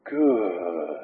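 low, soft, drawn-out single word; calm, peaceful sponsor. Retold or imitated. Sponsor answering sponsee's catastrophe call, imitated calm reply. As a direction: imitate an utterly unruffled reply, softly. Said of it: "the peace in that man's voice".

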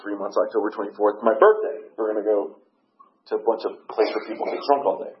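ordinary delivery

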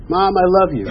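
retold affectionate persistence; insistent declaration. Repeats declaration to reluctant mother.

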